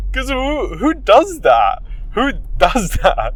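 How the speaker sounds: loud, high who does that; can't believe anyone would do it; incredulous. Real, not acted. Vlogger marvelling at his own prank, incredulous.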